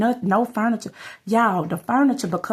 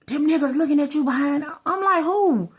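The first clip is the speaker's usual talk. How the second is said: quick, high-pitched mock paranoia; acted-out accusation; 7 semitones higher, faster than usual. Paranoid man accuses her over the TV, imitated high and fast. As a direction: imitate the exchange, pitched up and rushed.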